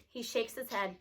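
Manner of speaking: usual speaking voice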